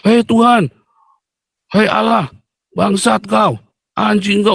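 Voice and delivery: high-pitched retold cursing at God; angry cursing. Preacher recalling how he cursed God, angry. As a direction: re-enact an outburst hurled up at heaven. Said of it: "saya maki-maki Tuhan".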